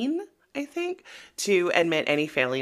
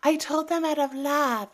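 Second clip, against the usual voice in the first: mocking Larsa; 9 semitones higher than usual. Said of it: "lame-ass justifications".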